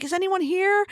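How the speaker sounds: high, searching call; acted freaking out. Retelling the heroine searching the lighthouse, panicked call. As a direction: call out high, hoping for an answer. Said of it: "she's freaking out".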